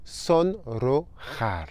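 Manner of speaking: sonrojar spelled out slowly with rolled r and throaty j; slow model word. Shown as a demonstration. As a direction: drag out sonrojar sound by sound. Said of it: "Ce premier mot".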